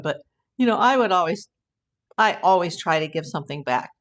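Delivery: usual speaking voice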